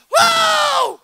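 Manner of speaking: very high shouted whoa; startled whoa. Retold or imitated. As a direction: cry out as if jolted by a shock. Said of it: "he's like".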